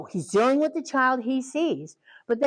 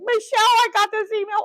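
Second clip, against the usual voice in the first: much higher, louder alarmed call; mock alarm; 12 semitones higher, 5 dB louder than usual. Director calling Michelle about the angry email, alarmed. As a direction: shriek it like a crisis hit. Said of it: "And I was like".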